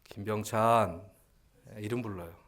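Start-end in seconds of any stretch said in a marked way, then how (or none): 0.0-1.1 loud, slow name call. Acted. Mother calling her crying child by name, calling out. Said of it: "이름 부르지요".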